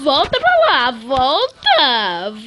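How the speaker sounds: alto e agudo, aflito; grito animado. Real, not acted. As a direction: gritar para o personagem voltar. Manda o personagem voltar. (grito aflito)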